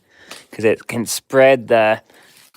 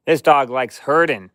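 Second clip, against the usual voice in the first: ordinary talk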